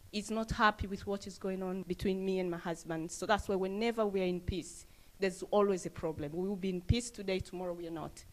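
normal talking voice

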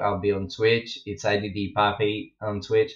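usual speaking voice

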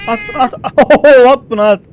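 loud, high urging; excited shout. Real, not acted. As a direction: yell at it to throw. Oyunda kediye bağırıyor. (heyecanla bağırarak)

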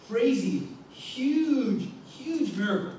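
huge stretched high and loud, rising to a peak; emphatic build on huge. Real, not acted. Preacher stressing huge to the congregation, emphatic. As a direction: punch huge up high to sell the wonder.

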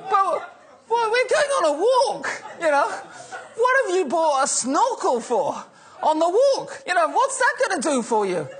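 barely holding in laughter at the absurd answer; laughing disbelief. Retold or imitated. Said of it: "And I'm like".

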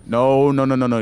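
loud, panicked denials. Real, not acted. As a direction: beg in a panic. Enemy right next to him.

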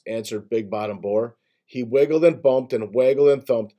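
plain reading voice

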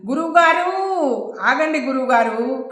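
ordinary delivery, nothing marked

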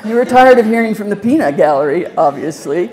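deadpan doctor's tease; mock surgeon quip.

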